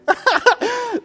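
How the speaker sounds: high, loud, teary laugh; emotional laugh. Real, not acted. Teacher overcome by feeling before the group, laughing. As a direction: let the laugh burst out, overcome. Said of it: "I'm moved now".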